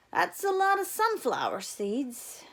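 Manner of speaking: a lazy wry comment from the guard; guard's voice. A narrator's reading. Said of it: "the bus guard said".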